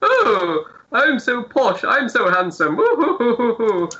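high, hooting posh puppet voice. Acted. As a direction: preen like a vain toff puppet. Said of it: "for the Zoltan one, he's like".

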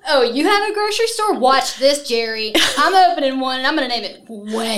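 high, cheeky one-upping voice; laughing spiteful sibling; taunting brother. Acted. Acts out the rival brother.

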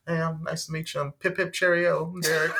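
hammed-up British "Pip Pip Cheerio"; mock British. Imitating the British date with a mock British accent. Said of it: "some British name".

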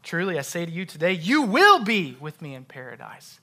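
quick lead-in, then "you will be" loud and punched; emphatic WILL in "you will be"; stress on WILL. Shown as a demonstration. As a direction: hit "will" hard, then fall away. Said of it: "just emphasize a different word".

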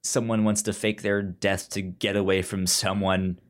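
normal talking voice

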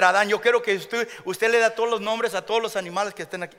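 lightly voiced God speaking quick Spanish; a playful divine command in Spanish, fast. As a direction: Dios hablando en español, en broma. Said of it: "you didn't know God spoke Spanish?".

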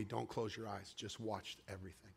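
very soft, low reassurance; quiet, calm inner voice; calming hush. Retold or imitated. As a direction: soothe gently, sinking almost to a whisper. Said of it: "calm my heart".

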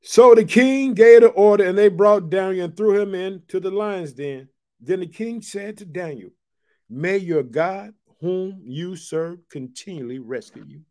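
loud read that slows to a hush on the king's blessing; scripture read from the pulpit. Acted. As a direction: proclaim the passage, then ease off. Preacher reads scripture aloud.

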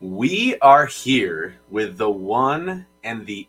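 slow, booming arena announcer; hype announcer intro. Acted. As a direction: announce him like a ring introduction.

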